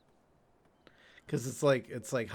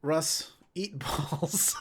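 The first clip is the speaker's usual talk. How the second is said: slow, laughing tease; laughing slowly; 4 dB quieter, much slower than usual. Real, not acted. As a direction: draw it out through laughter. Teasing chant, laughing.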